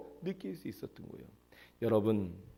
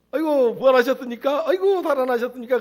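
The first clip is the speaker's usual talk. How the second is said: high and loud, amazed exclamation; startled greeting; 9 semitones higher, 12 dB louder than usual. Pretend. Churchgoers seeing the dead man appear, high shocked cry. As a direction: gasp it out in shocked disbelief.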